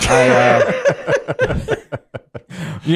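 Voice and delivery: laughing through the words. Real, not acted. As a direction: chuckle while you talk. Host laughing at a joke.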